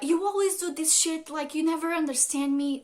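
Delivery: acting out a fed-up complaint; angry accusation. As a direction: voice an aggrieved partner throwing accusations. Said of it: "when they get angry again".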